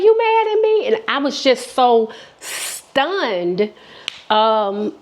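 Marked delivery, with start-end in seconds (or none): 0.0-0.9 much higher, timid asking; small worried voice of the friend; anxious friend. Retold or imitated. Guilty friend checking if she's mad, high. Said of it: "she's like".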